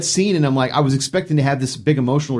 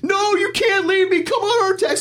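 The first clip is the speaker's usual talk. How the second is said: much higher, slow, hammed-up wailing plea; mock devastated hero; slower than usual. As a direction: overact the boy losing his horse. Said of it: "he's, like, devastated".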